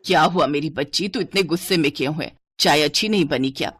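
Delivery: low-pitched motherly concern. Acted. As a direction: ask your upset child gently, pitched low. Mother soothes her angry daughter. (बेटी से चिंतित माँ, नीचे सुर में)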